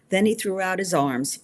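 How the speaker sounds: plain reading voice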